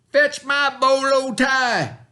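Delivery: high, loud, slow demand; bossy shout. A narrator's reading. Stepbrother demanding his tie, shouting high. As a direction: bark the order at him. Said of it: "shouted Dwayne".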